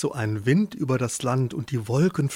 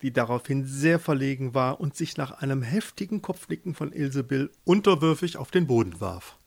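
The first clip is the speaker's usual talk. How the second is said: ordinary delivery, nothing marked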